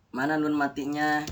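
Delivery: ordinary talk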